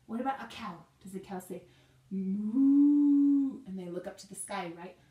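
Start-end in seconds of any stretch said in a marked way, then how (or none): 2.1-3.6 cow moo. Shown as a demonstration. Teacher voicing cow for kids.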